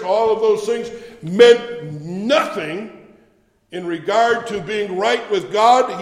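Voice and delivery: loud building preacher cadence, hammering each "all"; rising sermon climax, loud and insistent; preaching at full force. Acted. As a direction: preach it, driving the list home.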